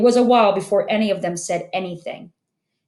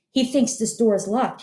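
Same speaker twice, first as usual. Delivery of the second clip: plain reading voice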